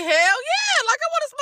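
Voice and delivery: high, eager hell yeah; excited yes. Retold or imitated. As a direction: jump at the offer. Eager yes to smoking invite.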